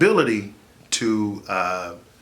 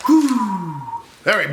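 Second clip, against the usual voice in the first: ordinary talk, nothing marked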